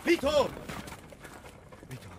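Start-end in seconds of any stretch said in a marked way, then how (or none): 0.0-0.5 desperate high call; anguished call. Acted. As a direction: cry his name out loud and high. Calling out to dying Vito.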